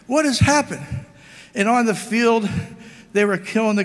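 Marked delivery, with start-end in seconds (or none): 0.0-0.9 shocked monk. Acted. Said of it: "he says".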